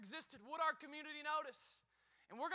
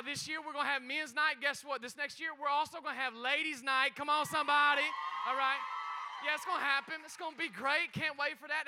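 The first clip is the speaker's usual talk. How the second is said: preaching at full force; 8 dB louder than usual. Acted. Hyping congregation, calls out.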